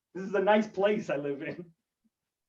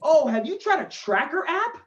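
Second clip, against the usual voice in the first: higher-pitched helpful suggestion; 6 semitones higher, 3 dB louder than usual. Acted. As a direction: pitch it up, offer helpful advice. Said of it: "And then he goes".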